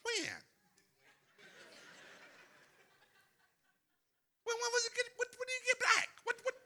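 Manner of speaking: shrill mock disbelief; way up high, baffled. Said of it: "I'm going like".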